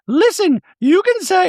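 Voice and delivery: high, prim voice for Dorit; Dorit impression. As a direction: play Dorit sweetly apologetic in a high voice. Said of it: "And Dorit's like".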